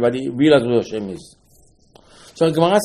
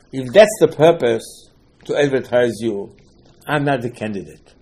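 ordinary talk

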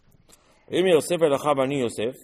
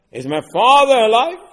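Yosef's question much higher and louder; urgent retold question; 7 semitones higher, 8 dB louder than usual. Yosef demands of his brothers, urgent loud question. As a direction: press the question hard, rising.

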